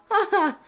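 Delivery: high, loud burst of laughter; short laugh. Real, not acted. Vlogger amused by their casino plan, short high laugh. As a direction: laugh out at your own remark.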